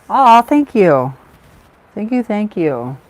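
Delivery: loud, laughing, touched thank you; flattered by a viewer's kind comment, laughing out loud. Real, not acted. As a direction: gush with laughter at the compliment. Thanked after a compliment.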